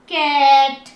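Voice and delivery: कैट loud, the "ai" held long and level; long vowel. Shown as a demonstration. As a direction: draw out कैट before a crisp final T. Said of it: "इस pronunciation को कितना लंबा खींच रही हूँ". (कैट ज़ोर से, "ऐ" लंबा खींचकर)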